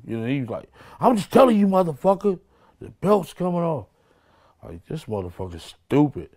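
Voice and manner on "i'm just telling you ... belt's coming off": much higher, hammed-up furious shouting; retold angry yell. Retelling how the captain yelled at him, in a mock furious yell. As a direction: play the enraged police captain threatening him.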